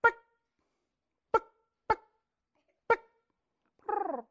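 soft clucking pick and purr; turkey hen call. Shown as a demonstration. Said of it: "sounds something like".

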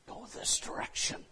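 soft, breathy whisper of guidance; God quietly guiding, whispered; whispered direction. Retold or imitated. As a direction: whisper it like a gentle inner nudge. God's voice giving directions.

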